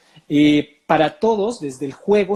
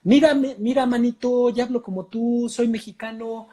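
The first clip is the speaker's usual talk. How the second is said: higher, laughing mock Mexican; fake Mexican accent; 7 semitones higher than usual. Foreign colleague boasts he sounds Mexican, mock Mexican accent. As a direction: play a foreigner proudly faking Mexican. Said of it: "me llegan y me dicen".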